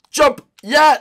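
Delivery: loud, high burst; excited shout. Real, not acted. Gamer urging his character over a gap, excited shout. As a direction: shout it out at the crucial moment. Calls out at a game jump.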